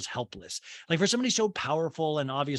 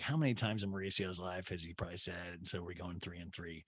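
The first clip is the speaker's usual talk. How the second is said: much softer, lower murmur; quiet aside; 8 semitones lower, 13 dB quieter than usual. Real, not acted. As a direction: mutter it as a side joke. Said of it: "That's an orgy joke".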